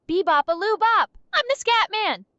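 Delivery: forced, loud and high hype; overly enthusiastic. Pretend. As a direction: oversell it, all fake cheer. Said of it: "in a forced, overly enthusiastic voice".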